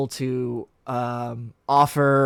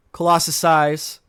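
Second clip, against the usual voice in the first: ordinary talk, nothing marked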